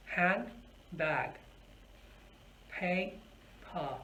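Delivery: "Hand, bag, pay, pa" with a gap after each syllable. Shown as a demonstration. Teacher counts syllables with hand under chin, split apart. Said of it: "break the word into its syllables".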